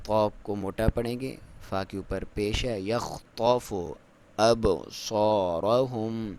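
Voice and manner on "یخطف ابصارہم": slow drawn-out tajweed chant; Quran recitation. Shown as a demonstration. Teacher recites the verse for students, slow chant. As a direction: recite it slowly with full tajweed. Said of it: "با کے اوپر کلکلا کریں گے".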